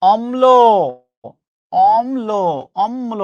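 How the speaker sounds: "অম্ল" as om-lo, each take set apart; pronunciation drill. Shown as a demonstration. Teacher drilling the class, "অম্ল" as om-lo. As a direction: repeat "অম্ল" deliberately, holding the m before lo. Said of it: "উচ্চারণটা কিন্তু এরকম".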